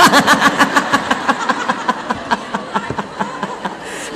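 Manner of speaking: long laugh trailing away; laughter. Real, not acted. Speaker laughing at forgetting the three questions, amused. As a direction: laugh it out for several seconds.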